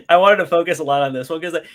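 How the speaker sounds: ordinary talk, nothing marked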